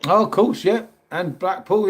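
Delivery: usual speaking voice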